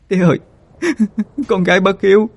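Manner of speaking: sobbing. A narrator's reading. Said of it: "mà nức nở".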